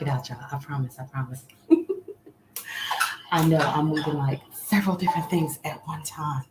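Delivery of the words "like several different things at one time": usual speaking voice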